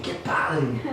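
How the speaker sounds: a light Mexican "¡Qué padre!" meaning "how cool"; Mexican exclamation "qué padre". Retold or imitated. Preacher imitates Mexicans: casual slang exclamation. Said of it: "Los mexicanos dicen".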